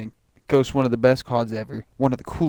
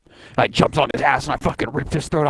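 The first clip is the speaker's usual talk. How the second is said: ordinary talk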